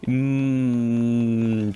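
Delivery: long, loud, steady hummed bleep; monotone hum standing in for a curse; hummed censor bleep. Acted. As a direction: hold a loud "mmm" to cover the swear. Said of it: "says censored word that I shouldn't say".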